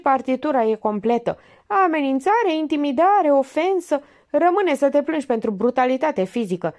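high, pressing detective voice; Minerva interrogating. A narrator's reading. Minerva grills the suspect actor, high and pressing.

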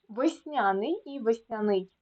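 ВЕСНЯНИЙ said twice with the stress moved; double stress. Shown as a demonstration. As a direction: show both accepted stresses of Весняний, middle and last syllable. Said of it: "слова з подвійним наголосом".

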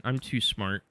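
usual speaking voice

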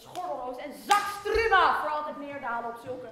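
roaring the curse at full volume; a loud furious curse peaking; shouted curse. Acted. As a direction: bellow the final curse. Climax of a long curse.

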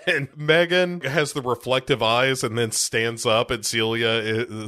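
ordinary talk

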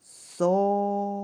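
сова split as со-ва, each syllable held; chant-like, drawn-out со... ва; syllable check. Shown as a demonstration. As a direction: sound out сова in two slow beats. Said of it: "Перевіримо".

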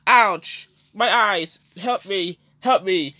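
high loud cries of pain; pepper spray pain. Acted. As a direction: yell out as if eyes are burning. Just pepper sprayed, crying out.

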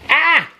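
short high "ah"; mock cry for a thumbnail pose; posed yelp. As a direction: give a quick, high-pitched yelp. Posing for the thumbnail.

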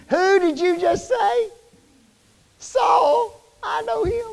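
high, slow disbelieving gasp of a question; stunned neighbour. Retold or imitated. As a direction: play the shocked townsman who can't believe it. Townsfolk stunned at hearing Saul's name.

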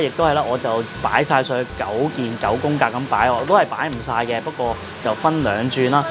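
ordinary talk

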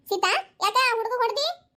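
high, drawn-out scolding question; reproachful grandmother. Acted. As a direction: call her to account, stretching the words. Grandmother confronts mother for hitting.

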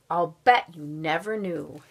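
usual speaking voice

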